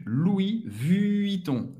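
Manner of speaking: Louis Vuitton drawn out slowly; Louis Vuitton bem devagar. Shown as a demonstration. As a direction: stretch each syllable of Louis Vuitton. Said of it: "Rápido".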